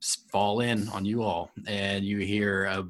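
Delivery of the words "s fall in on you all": ordinary talk, nothing marked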